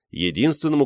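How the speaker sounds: ordinary delivery, nothing marked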